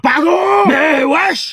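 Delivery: high, loud disbelief; shocked outburst. Acted. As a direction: cry out scandalised. Parents choqués par la bêtise. (exclamation choquée, forte et aiguë)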